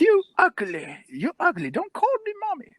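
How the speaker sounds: put-on high, squealing old-lady voice; indignant old woman. Homeless woman objecting to the guard, high mock indignation.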